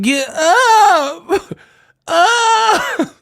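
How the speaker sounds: high squealed falsetto, stretched; mocking yell. Shown as a demonstration. Showing how he would never say it, squealed mock yell. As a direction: shriek it as the wrong way to say it. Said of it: "I wouldn't be like".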